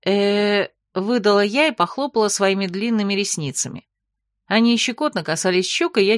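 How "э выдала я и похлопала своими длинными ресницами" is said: ordinary delivery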